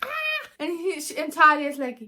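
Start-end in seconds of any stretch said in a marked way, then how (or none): 0.0-0.5 high startled yelp; quick high yelp of surprise. Retold or imitated. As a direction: jump at the gnome with a squeak. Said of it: "he's like".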